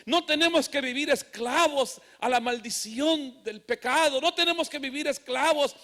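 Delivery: insistent preacher building in force; impassioned preaching. Real, not acted. Preacher urging the church that there is hope, impassioned. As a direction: drive home each repeated phrase.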